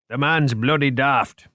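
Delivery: light Scottish accent, lightly put on; a hint of a Scottish colleague; Cuthbert Scottish accent. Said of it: "Moriarty imitated Cuthbert's Scottish accent".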